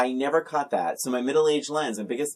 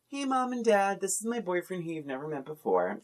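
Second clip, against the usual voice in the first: mock Chelsea voice; 6 semitones higher, 4 dB quieter than usual. Mocking the character Chelsea.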